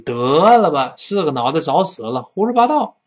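scoffing disbelief. Acted. Answering a tall tale about a neighbour, scoffing disbelief. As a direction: brush off a ridiculous claim. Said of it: "对方说了一个非常离奇的话".

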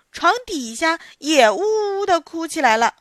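usual speaking voice

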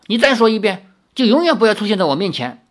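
high, loud and fast threat; angry. Acted. Girl angrily threatening her suitor, high and fast. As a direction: snap out the warning angrily. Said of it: "林月如怒道".

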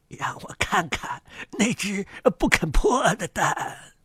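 put-on slow, soft, creaky old duck; quiet, drawn-out old duck voice. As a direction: take your time, like an old busybody duck. Old duck's voice.

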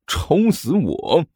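enraged roar. A narrator's reading. Zhang Yefei roars while struggling free, enraged cry. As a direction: stretch the words out in anger. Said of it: "大声咆哮道".

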